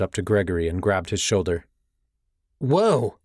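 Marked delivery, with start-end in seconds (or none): 2.6-3.2 high, startled exclamation; startled cry. Acted. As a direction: yelp in surprise. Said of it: "he cried".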